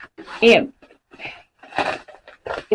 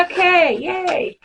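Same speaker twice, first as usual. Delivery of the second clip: little cheer; 9 semitones higher, much slower than usual. Real, not acted. Small celebration.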